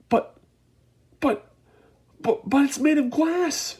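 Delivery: high, loud panicked stammer; stammering shout. A narrator's reading. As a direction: sputter in fear. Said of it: "shouted Grandpa Joe".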